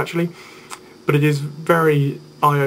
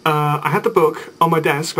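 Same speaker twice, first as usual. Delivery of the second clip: ordinary talk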